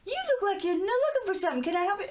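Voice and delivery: high, quick call down the aisle; imitated friendly yelling. Shopper hailing a stranger down the store aisle, imitated yell. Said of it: "someone was yelling".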